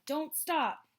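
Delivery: high, loud urgent yell. A narrator's reading. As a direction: urge them on while running. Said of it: "would yell".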